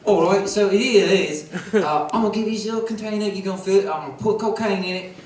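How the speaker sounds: rough British dealer voice; hammed-up British cocaine dealer giving terms; bad British accent. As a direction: put on a clumsy British accent. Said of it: "some British guy got really mad".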